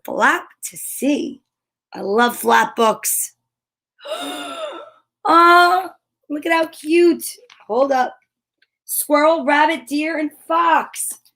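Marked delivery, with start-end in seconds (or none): 4.0-4.8 sharp, high intake of breath; gasp. Real, not acted. Lifting the flap, a delighted gasp. 5.2-7.2 higher, louder coo; delighted aw. Real, not acted. Seeing baby animals, a delighted coo. Reacting to cute picture.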